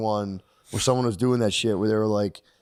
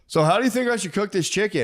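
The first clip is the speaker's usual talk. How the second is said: higher, dopey customer voice; mimicking customer; 8 semitones higher than usual. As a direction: play the clueless shopper asking. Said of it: "this guy's like".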